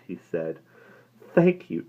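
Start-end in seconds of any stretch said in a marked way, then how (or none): 1.3-1.9 despondent. A narrator's reading. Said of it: "she said despondently".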